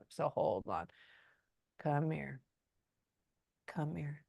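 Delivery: hushed coaxing under the breath; quiet aside. Real, not acted. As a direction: say it low and soft, as if to someone unseen. Murmured aside to the spirit.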